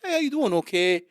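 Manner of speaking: high, loud, chirpy Toronto greeting; very pleasant. Acted. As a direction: play a super-sweet Canadian. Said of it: "Very p-, very pleasant".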